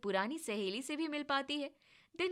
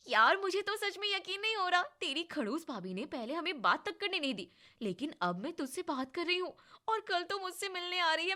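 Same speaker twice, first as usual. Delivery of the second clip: excited; 6 semitones higher than usual. Acted. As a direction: bubble over with disbelief and joy. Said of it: "मैं बहुत excited हूँ".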